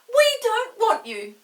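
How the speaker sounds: put-on very high, loud cross girl; cross dismissal. Molly sends Chinky away, loud and huffy. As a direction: send him off like a huffy sister. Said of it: "said Molly at once".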